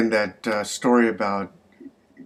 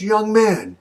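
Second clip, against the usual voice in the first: high, loud indignant address; scolding; 11 semitones higher, 5 dB louder than usual. Acted. Missus Shapiro correcting the young clerk, indignant scolding. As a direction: pull him up sharply, high and loud.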